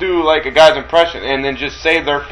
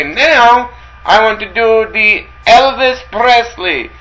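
slow, higher mock announcement; 8 semitones higher, 4 dB louder, much slower than usual. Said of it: "he would say".

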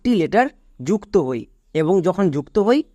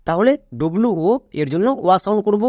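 ordinary talk

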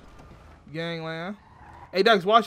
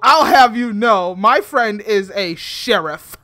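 loud, slow, pompous declaration; haughty loud warning; booming mock threat; 11 dB louder, much slower than usual. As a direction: puff up and boom it out. Pompous threat, much louder and slower.

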